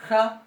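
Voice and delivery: "ja" alta y fuerte, fricativa. Shown as a demonstration. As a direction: marca la fricción de "ja". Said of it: "la primera fricativa va a ser". ("ja" fricativa enfatizada)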